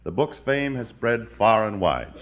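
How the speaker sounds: ordinary delivery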